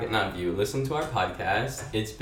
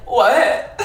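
incredulous; 13 dB louder than usual. Retold or imitated. Retelling waking her roommate.

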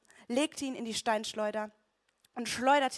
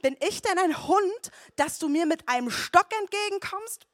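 roaring giant; 9 semitones higher, 8 dB louder than usual. A narrator's reading. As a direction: roar the insult down at him. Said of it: "brüllte Goliath ihn an".

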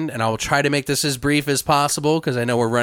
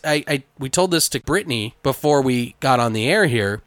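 ordinary talk

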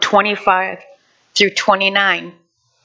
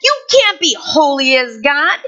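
higher mocking voice of the world; mock taunting world; 7 semitones higher than usual. Preacher voicing the world's taunts, higher and mocking. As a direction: sneer it like an accuser. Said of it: "the world screams and yells at you".

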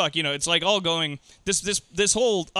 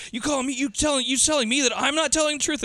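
much higher, rising outrage; mock outrage; 10 semitones higher than usual. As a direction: act insulted and explode. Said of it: "He's like".

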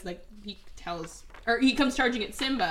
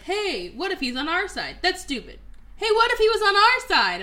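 high, louder cartoon meerkat; hammed-up idea-stealing squeak; mock Timon; 10 semitones higher, 6 dB louder than usual. Timon stealing Pumbaa's idea, in a high loud squeak.

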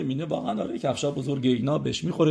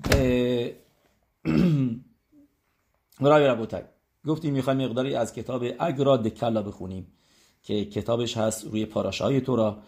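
ordinary delivery

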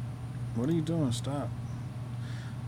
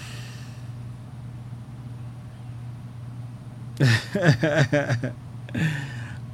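long, voiceless laugh under the breath; silent laugh; 2 semitones higher, 5 dB quieter than usual. Real, not acted. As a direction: shake with laughter but let no voice out. Reacting to the grift clip.

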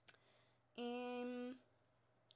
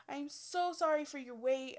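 sweet apologetic voice; 4 semitones higher, 5 dB louder than usual. Retold or imitated. Apologizing to waiting client.

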